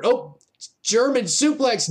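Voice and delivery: normal talking voice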